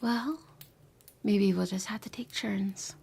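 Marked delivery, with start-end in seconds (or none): 1.2-3.0 playful, hushed tease; teasing whisper. Acted. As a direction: whisper it with a sly grin.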